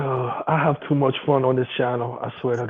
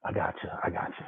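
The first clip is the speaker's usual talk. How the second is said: whispered agreement; 9 semitones lower, 15 dB quieter than usual. Real, not acted. Quiet aside agreeing.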